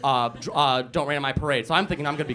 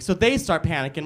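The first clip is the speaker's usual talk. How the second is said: ordinary talk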